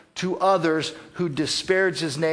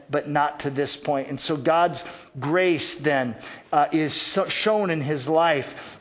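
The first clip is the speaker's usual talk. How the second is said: ordinary talk, nothing marked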